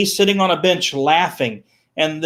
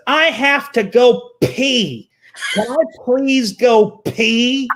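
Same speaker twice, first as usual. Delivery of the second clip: put-on higher, slow pleading kid voice; pleading child; 5 semitones higher, slower than usual. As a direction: beg like a small boy who needs the toilet. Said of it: "And he said".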